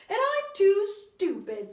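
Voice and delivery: high, loud, slow whine; whiny kid. A narrator's reading. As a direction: complain like a class clown. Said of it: "said Todd".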